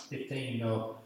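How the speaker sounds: normal talking voice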